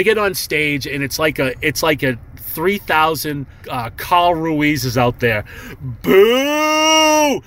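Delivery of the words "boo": long, high-pitched imitated boo; sustained mock jeering "boooo"; crowd boo. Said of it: "getting the shit booed out of me".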